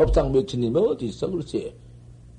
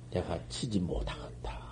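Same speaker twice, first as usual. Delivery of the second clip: much softer, whispered verdict; 속삭이는 인용; whispered quote; 11 semitones lower, 15 dB quieter than usual. Retold or imitated. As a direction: drop to a whisper for the master's words. Quoting the master's verdict.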